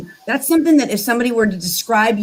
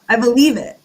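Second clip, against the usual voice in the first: ordinary talk, nothing marked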